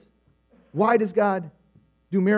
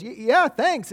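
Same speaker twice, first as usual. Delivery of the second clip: flattered stammer; 7 semitones higher, much slower than usual. Retold or imitated. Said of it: "thinking it's a compliment and goes".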